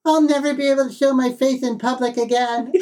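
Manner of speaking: high lisping cartoon cat, dramatically ashamed; Sylvester voice. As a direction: wail it like a shamed cartoon cat. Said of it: "where he says".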